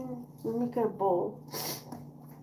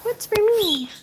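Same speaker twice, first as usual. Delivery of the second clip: high pleading pet voice; squeaky begging cat voice; 8 semitones higher, 4 dB louder, slower than usual. Pretend. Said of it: "They say".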